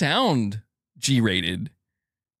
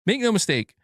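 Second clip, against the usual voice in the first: ordinary talk, nothing marked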